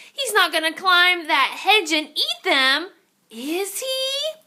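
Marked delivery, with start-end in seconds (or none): none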